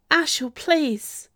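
high, slow, pleading gasp; tearful plea. A narrator's reading. Duchessa begging her attacking cousin, tearfully pleading. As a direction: draw the plea out, near tears. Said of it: "the Duchessa gasped, with tears in her eyes".

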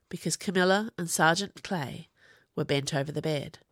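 plain reading voice

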